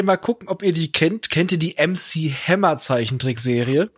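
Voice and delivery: ordinary delivery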